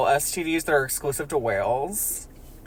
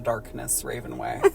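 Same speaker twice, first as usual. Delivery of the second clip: ordinary talk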